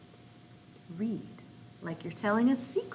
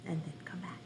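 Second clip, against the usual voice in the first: soft whispered aside; 6 semitones lower, 14 dB quieter, faster than usual. Shown as a demonstration. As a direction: whisper it quietly. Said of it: "in a whisper".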